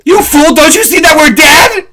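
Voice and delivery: high, loud hammed-up shout; mock dramatic outburst. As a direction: yell it like a doomed movie hero. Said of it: "No, I'm just kidding".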